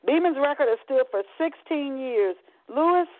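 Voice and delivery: lively TV sports announcer; sportscaster voice. Acted. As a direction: call it like a sports anchor. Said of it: "an E S P N sportscaster announced".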